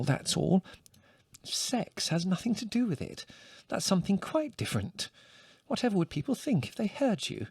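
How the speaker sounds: fluster and hush the topic; scandalised mother. A narrator's reading. Mother embarrassed by son's remark, flustered scolding.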